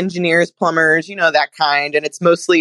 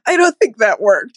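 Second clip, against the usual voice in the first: higher, squeezed imitation of helpless laughter; retold crying-laughing; 5 semitones higher than usual. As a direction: mimic talking through tearful laughter. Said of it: "we were crying, laughing, going".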